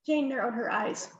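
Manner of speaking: ordinary delivery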